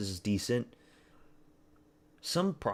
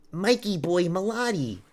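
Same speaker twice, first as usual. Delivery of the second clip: Mikey boy Malati tossed off much higher in a put-on voice; mocking nickname; 9 semitones higher, 4 dB louder than usual. Streamer ribbing the Canadian fighter for chat, high goofy put-on voice. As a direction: tease him like a cartoon announcer.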